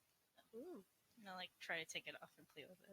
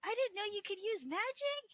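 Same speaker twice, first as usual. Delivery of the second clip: high, loud, thrilled squeal; amazed fan; 12 semitones higher, 9 dB louder than usual. Acted. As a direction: marvel at the hero, bursting with excitement. Emil marvels at winner.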